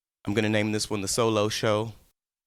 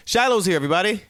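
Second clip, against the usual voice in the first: excited announcement; 8 semitones higher, 7 dB louder, faster than usual. Real, not acted. Announcing a friend.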